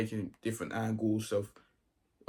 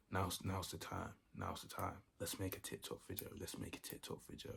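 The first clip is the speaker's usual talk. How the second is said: hushed scheming; 12 dB quieter than usual. Retold or imitated. Plotting a TikTok while teacher is away, hushed scheming. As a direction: propose mischief under your breath.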